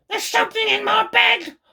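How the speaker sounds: very high frantic hag voice; panicked old hag. Acted. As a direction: cry it out in a shrill panic. Mrs Twit panics.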